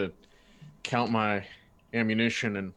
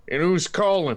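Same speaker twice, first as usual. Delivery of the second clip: calling out; 8 semitones higher, 8 dB louder than usual. Acted. Player voices character calling back.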